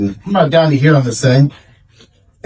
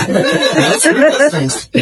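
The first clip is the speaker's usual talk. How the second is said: high, slow, laughing mock eagerness; drawn-out laughing cheer; 8 semitones higher, much slower than usual. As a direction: gleefully ham up the eagerness. Eager mock excitement over O'Doul's.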